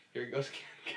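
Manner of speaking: talking through a yawn. Real, not acted. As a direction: say it mid-yawn. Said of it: "'cause he just yawned".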